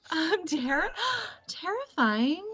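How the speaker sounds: high gasp of feigned offence; gasp, then question it high, as if wounded; mock shock. Actress reacting to being called terrifying, mock shock.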